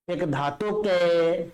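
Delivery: "एक धातु के" very slow, left hanging on "के"; pause after के. Shown as a demonstration. Teacher showing students where to stop, holding "के". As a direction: stretch "के" long, then halt. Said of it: "यहां रुक जाना है".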